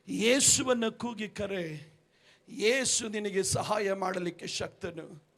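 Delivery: hushed pastoral reassurance; gentle comfort. Retold or imitated. Pastor comforts kneeling mother.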